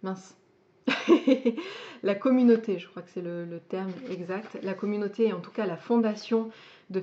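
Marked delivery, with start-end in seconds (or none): 0.9-2.0 short, higher, louder burst of laughter; self-conscious laugh. Real, not acted. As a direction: laugh at your own memory slip. Laughs after losing the word.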